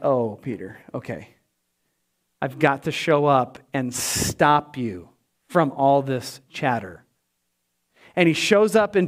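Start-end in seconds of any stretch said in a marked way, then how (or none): 0.0-7.0 a tired parent done with the chatter; weary patience. Retold or imitated. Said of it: "God the Father's like".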